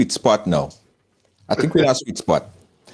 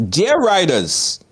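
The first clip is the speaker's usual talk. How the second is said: slow, higher read-out of a letter; reading aloud; 6 semitones higher, much slower than usual. Acted. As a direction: read it slowly like a comic letter. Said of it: "let me read it".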